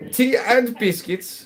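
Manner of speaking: high, slow put-on British accent. Streamer to chat, faking a posh British accent. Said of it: "I go like".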